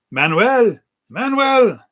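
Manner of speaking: high, loud exasperated calling; Fawlty yelling. Retold or imitated. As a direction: bellow the waiter's name across the hotel. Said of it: "Emmanuel, the guy from Barcelona".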